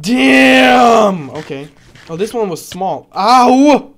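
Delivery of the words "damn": sudden loud damn; loud shout. Real, not acted. Player hit by the dragon, loud shout. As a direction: yell out at the hit.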